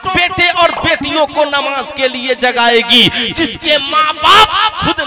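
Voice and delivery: high, loud sermon cries to the crowd; fiery preaching. Acted. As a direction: rouse the congregation, shouting. Preaching at full force.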